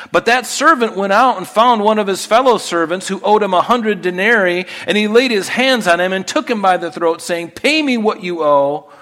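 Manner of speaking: loud, high, ringing scripture reading; full-force preaching. Acted. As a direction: proclaim the parable to the whole church. Reads the parable at full force.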